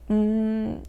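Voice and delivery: normal talking voice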